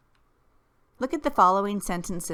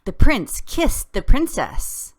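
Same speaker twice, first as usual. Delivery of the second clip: higher, louder, slow, thrilled; excitement; 4 semitones higher, 7 dB louder than usual. Shown as a demonstration. Teacher reading a fairy tale line with excitement. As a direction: squeal it out with delight.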